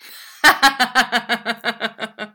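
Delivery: loud, high staccato cackle; long cruel laugh, voiced in character; mocking laugh. A narrator's reading. Schoolmaster's wife amused by his threat, mocking laugh. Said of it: "Madame Squeers se mit à rire".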